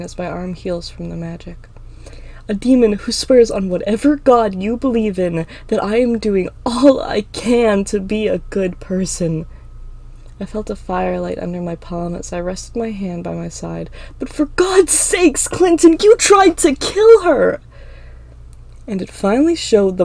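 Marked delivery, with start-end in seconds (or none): 2.5-9.5 loud, emphatic vow; impassioned protest. Acted. Emma swears to Clinton she tries, impassioned protest. 14.2-17.6 very high, loud, slow shout; outraged yell. Acted. Emma confronts Clinton over her sister, outraged yell.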